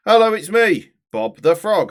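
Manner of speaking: loud, high cartoon frog greeting; Bob the Frog. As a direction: belt out a frog puppet's hello. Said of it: "Bob's your fave voice".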